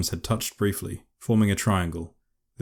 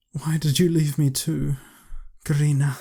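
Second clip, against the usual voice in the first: higher, aching wistful groan; 8 semitones higher than usual. A narrator's reading. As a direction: groan it through gritted teeth. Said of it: "she groaned wistfully, through gritted teeth".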